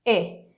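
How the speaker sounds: short closed "e", as "eh"; isolated demo of the vowel "e"; e sound. Shown as a demonstration. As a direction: give the vowel "e" alone, briefly. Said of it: "Aqui é um som de e".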